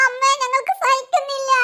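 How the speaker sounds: wailing farewell cry; hammed-up sobbing wail; acted crying. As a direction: wail to mother as if unable to bear it. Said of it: "നിങ്ങളിങ്ങനെ കരയല്ല".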